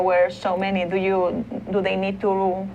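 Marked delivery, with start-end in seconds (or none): none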